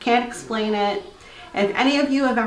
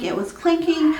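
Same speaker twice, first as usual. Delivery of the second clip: higher, mock-irritated complaint; mild retold anger; 7 semitones higher than usual. Wife griping to her husband, mock annoyed. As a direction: huff about the noise, half-playing. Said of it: "I got angry".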